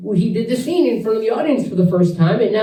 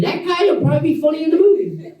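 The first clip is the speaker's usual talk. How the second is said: high amused; 8 semitones higher, faster than usual. Retold or imitated. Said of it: "they said".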